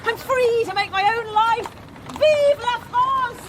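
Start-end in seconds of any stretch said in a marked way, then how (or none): 2.1-3.4 defiant cheer. Acted. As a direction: shout it like a victory toast. Becky defiantly toasting her freedom.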